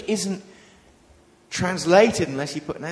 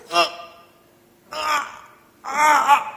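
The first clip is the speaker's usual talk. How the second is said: high, strained stammering uh; agonized straining uh; 10 semitones higher, 5 dB louder, slower than usual. Retold or imitated. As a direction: strain like a kid desperate to answer. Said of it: "they sometimes look agonized".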